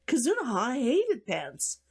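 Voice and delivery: plain reading voice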